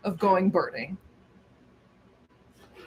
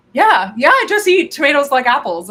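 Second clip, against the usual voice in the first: loud, higher, chewing while talking; excited mouthful; 4 semitones higher, 9 dB louder than usual. Real, not acted. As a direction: blurt it out between bites. Excited while eating tomatoes.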